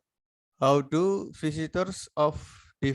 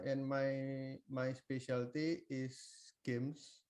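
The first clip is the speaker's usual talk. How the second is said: faint, softer voice off mic; barely audible; 14 dB quieter, slower than usual. Real, not acted. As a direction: voice fading out of reach. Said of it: "your voice is very low".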